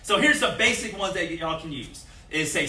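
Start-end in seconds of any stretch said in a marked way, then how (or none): none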